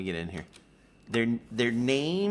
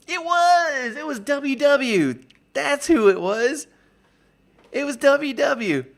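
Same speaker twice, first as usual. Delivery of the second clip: much higher and louder, eureka; excited recall; 14 semitones higher, 4 dB louder than usual. Real, not acted. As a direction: shout it as it comes back to you. Suddenly remembers the donor.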